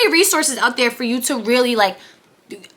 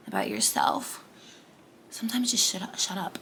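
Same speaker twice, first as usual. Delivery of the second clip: whispered; 6 semitones lower, 12 dB quieter than usual. Real, not acted. Whispering advice to the viewer. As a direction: drop to an unvoiced whisper.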